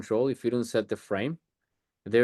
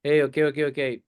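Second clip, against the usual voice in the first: higher, louder cutting-in on an argument; raised voice breaking in with quick repeated okays; interrupting; 4 semitones higher, 4 dB louder than usual. Shown as a demonstration. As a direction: cut in over two people arguing to take control. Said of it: "I'll just interrupt like that".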